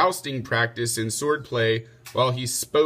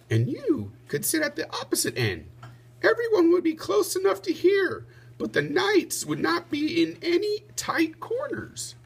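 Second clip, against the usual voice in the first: Lady Di's voice. Acted. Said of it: "drew a diagram and said".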